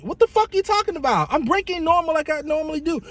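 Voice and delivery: high, fast, incredulous snap; exasperated retort. Retold or imitated. Driver snapping at the nagging passenger, high incredulous retort. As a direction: fire it back in disbelief.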